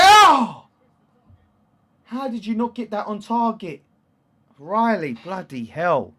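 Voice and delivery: very high, very loud "Oh!" then a loud high rant; frustrated shout. Real, not acted. Streamer shouting at Riley for missing, frustrated. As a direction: yell at the player who missed. Player misses the target.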